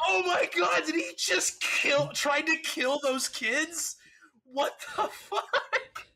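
high, loud, slow, disbelieving shout; shocked shout. Retold or imitated. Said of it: "And I'm like".

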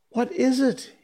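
put-on high-pitched alarmed boy's cry; startled, fearful question, pitch far up; frightened cry. A narrator's reading. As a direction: cry out high, shrinking back. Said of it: "he cried, shrinking".

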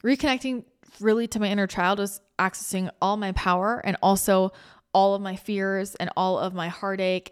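ordinary talk, nothing marked

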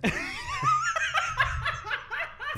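high, rising burst of laughter. Real, not acted. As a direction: crack up suddenly.

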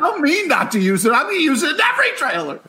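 high, shouted macho boast; Zack Snyder. Acted. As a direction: yell it like an overexcited gym bro. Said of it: "He's like".

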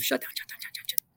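whispered, urgent shut down; mock frantic whisper. Pilot to crew chief, whispering shut down. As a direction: hiss it like a secret signal.

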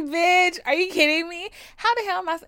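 loud, high, incredulous yell; outraged shout. Retold or imitated. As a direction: blast it at her in disbelief. Acted outraged reply.

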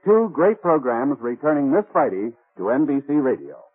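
ordinary talk, nothing marked

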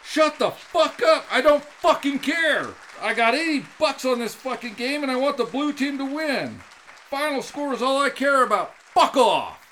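hammed-up drunk sports fan rant; angry shout. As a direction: bark it at the know-it-all. Angry bar guy rant.

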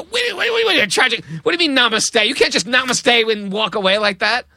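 high-pitched, frantic retold protest; mock panic, pleading with the sadhu; alarmed protest. Panicked reply to death prophecy.

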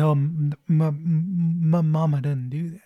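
lightly imitated simple Southern character, stuttering slowly; Forrest Gump. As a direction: stumble over the m's in a mild, mock Southern voice. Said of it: "I think that was Forrest Gump right there".

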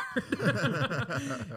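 a real, high burst of laughter at the memory; hearty laugh. Real, not acted. Friend recalling a funny movie scene, real laughter.

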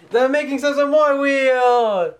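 game show host. Acted. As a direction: present the wheel like a game host. Host announces game wheel.

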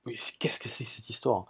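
whispered, baffled aside to himself; puzzled whisper. Retold or imitated. Tourist hears Marseille chant abroad, whispers in disbelief. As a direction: hush it like a private double take. Inner puzzled thought, retold.

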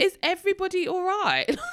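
high, loud, laughing disbelief; retold bewilderment. Retells bewildered reaction to crying.

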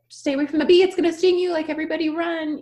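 high, loud alarmed yelling; panicked shout. Pretend. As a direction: sound the alarm in mock panic. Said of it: "if I was like".

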